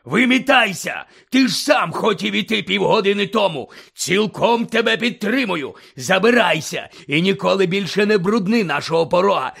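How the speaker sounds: loud, high furious tirade; raging rant. Acted. Uncle ranting at Harry, furious shouting. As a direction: spit every word out in rage.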